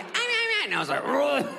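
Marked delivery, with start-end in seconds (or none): 0.0-0.6 very high, quick yapping; former skinny bark. Retold or imitated. How Scooby used to bark, high yapping. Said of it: "It used to be". 1.0-1.5 single heavy, rolled woof; one big overweight-dog bark; fat bark. Retold or imitated. How fat Scooby barks now, heavy woof. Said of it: "It's a fat bark".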